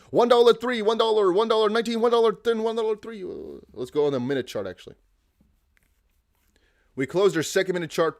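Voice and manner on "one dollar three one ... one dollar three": auctioneer. Pretend. Continues auctioneer calling.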